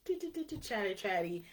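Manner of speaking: high-pitched mimicked small talk; mock chit-chat. Host mimicking small talk at networking events, mock chit-chat. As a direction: rattle off empty networking chatter in a high voice.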